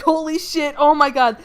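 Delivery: acted shocked panic. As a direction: blurt it out like someone about to be chased. Acting a chased character's shock.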